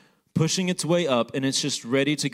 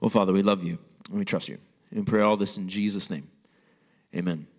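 lower, hushed prayer voice; 6 semitones lower than usual. Acted. Pastor leads an opening prayer.

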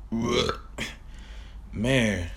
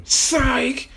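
much higher, loud squeal; shrieked psych; 5 dB louder than usual. Acted. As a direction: shriek it out as a twist. Sudden shriek twist.

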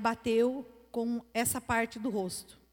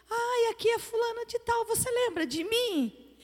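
limp, feeble caller; 11 semitones higher than usual. Retold or imitated. Woman phoning an old friend to ask if she's remembered, limp voice. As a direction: imitate a woman's weak voice on a call. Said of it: "uma voz meio mole".

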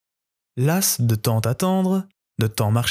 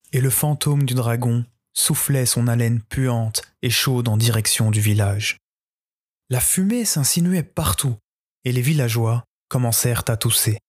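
ordinary delivery

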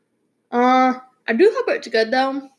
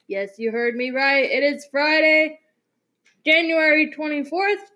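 usual speaking voice

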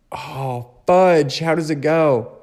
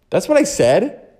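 incredulous; 8 semitones higher, 4 dB louder than usual. Real, not acted. Amused disbelief at own slip.

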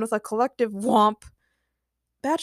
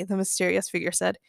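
ordinary delivery, nothing marked

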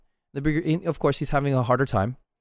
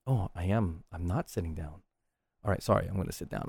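sheepish; 4 semitones lower, 10 dB quieter than usual. Retold or imitated. Realizes he's standing, apologizes.

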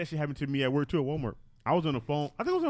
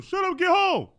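loud, high-pitched mock bellow; shouted police order; 9 dB louder, slower than usual. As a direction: yell to shut down any backtalk. Acting out the officer's order.

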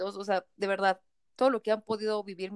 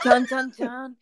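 high, loud dramatic chan chan chan; hammed-up suspense jingle; mock suspense; 4 semitones higher, 5 dB louder, much slower than usual. Friend hams up the suspense of the story, mock dramatic.

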